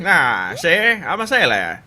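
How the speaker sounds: slow, hearty sea-dog boast; sailor voice. Acted. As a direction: drawl it out like a proud sailor. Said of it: "This is my sailor voice".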